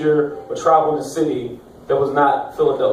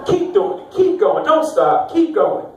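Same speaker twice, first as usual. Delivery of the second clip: high-pitched imitation of passionate egging on; mock passionate urging; 10 semitones higher, slower than usual. Overheard girlfriend urging her boyfriend on, high and mock-passionate. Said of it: "have the loudest, most passionate".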